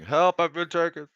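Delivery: high, loud captive's holler; yelling for help. Acted. Transformed captive still calling to guards, high desperate yell. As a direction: scream out for rescue. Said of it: "he's still yelling".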